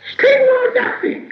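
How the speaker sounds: very high, loud creature shriek. A narrator's reading. Gollum shrieking two last guesses at once, piercingly high. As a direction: screech it, stretched out slowly. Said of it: "shrieked Gollum".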